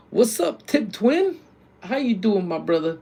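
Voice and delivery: high, loud shout-out; excited greeting. Real, not acted. Streamer welcomes a friend joining the chat, excited greeting. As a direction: hail him high and lively. Greeting a viewer who joined.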